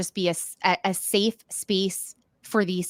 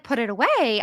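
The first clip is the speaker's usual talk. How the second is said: normal talking voice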